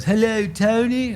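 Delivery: lightly put-on English accent, high and slow; a polite English caller greeting Tony. Imitating an English caller's greeting, slow and high. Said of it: "English accent".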